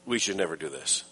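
low, quiet, pointed warning; firm admonition. Acted. Preacher telling hearers right between the eyes, quietly firm. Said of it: "told right between the eyes".

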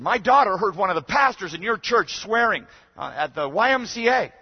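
high, loud mock outrage; upset mother. Upset mother reporting a swearing pastor, hammed-up outrage. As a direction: imitate an indignant mother making an accusation. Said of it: "I could tell she was upset".

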